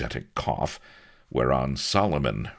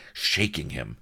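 ordinary delivery

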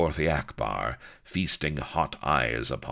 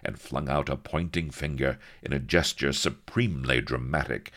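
plain reading voice

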